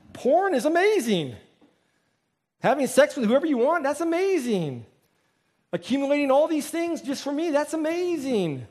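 high-pitched gushing, put on; exaggerated awe, stretching the key word; mock enthusiasm. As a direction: rave about it with hammed-up delight. Mimicking worldly enthusiasm for sin.

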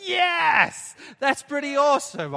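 high, loud delighted crowd reply; excited yes. Pretend. Pastor voicing the hoped-for crowd reaction, excited. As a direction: cheer it like an amazed congregation. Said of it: "I was kinda hoping you guys would go like".

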